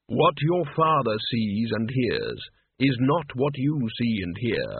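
slow, measured voice of Death; solemn Death. Acted. Death telling the boy whom he came for, slow and solemn. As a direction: intone each phrase slowly and gravely.

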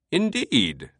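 high, amused British voice; amused British eccentric; deeply amused British. A narrator's reading. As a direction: relish it in a British accent. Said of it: "Teabing sounded deeply amused now".

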